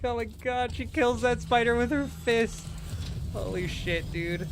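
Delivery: high-pitched, mock-horrified squeal; horrified amazement. Husband recalling wife's fist killing a spider, horrified amazement.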